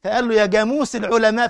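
جاموس said with g instead of q; villager's accent. Retold or imitated. Preacher retells the villager's praise, g for q. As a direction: Upper Egyptian g for the q in قاموس. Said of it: "القاف جيم".

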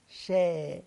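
شا مرققة; light sha. Shown as a demonstration. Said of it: "حرف مرقق قوي".

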